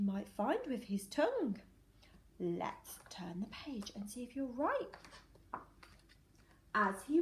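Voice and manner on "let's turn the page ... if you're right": whisper. Real, not acted. Teacher whispers page-turn teaser.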